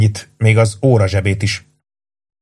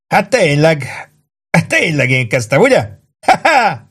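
high, amused, breaking into ha-ha; acted laughter rising high at the end; groaning with laughter; 7 semitones higher than usual. A narrator's reading. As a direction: admit it through helpless mirth. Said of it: "nyögött Warden".

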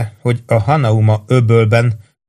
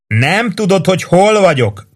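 high shouted retort; calling back; 9 semitones higher than usual. A narrator's reading. Sergeant calling back to the chief. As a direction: call back with a wink. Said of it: "kiáltott vissza Warden".

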